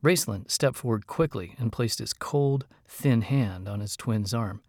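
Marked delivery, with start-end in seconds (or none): none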